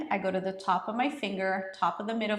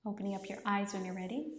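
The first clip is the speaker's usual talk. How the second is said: soft guided voice; 7 dB quieter than usual. Acted. Guides them out of practice.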